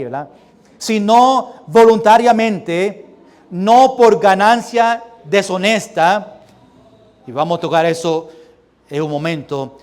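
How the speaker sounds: normal talking voice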